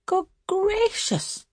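very high, drawn-out exclamation; high-pitched indignant cry; shocked exclamation. A narrator's reading. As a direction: exclaim it high and slow, aghast. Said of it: "exclaimed Bertha".

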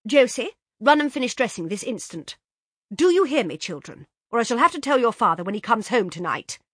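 put-on a busy hostess firing off orders to the children; flustered mother. A narrator's reading.

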